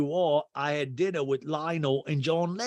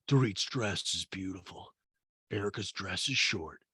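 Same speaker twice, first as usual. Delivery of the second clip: low, softer impression of a man; Mauricio; 4 semitones lower, 6 dB quieter than usual. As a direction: imitate a man commenting, pitched down. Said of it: "And Mauricio's like".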